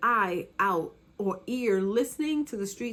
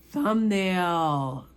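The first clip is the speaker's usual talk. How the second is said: ordinary talk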